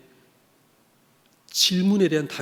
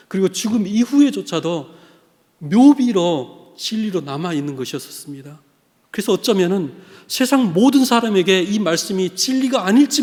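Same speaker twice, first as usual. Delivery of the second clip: loud, raised preaching voice; impassioned preaching; 5 semitones higher, 7 dB louder than usual. Real, not acted. Preacher testifying to the missionary's lifelong faith, impassioned. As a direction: preach it out with conviction.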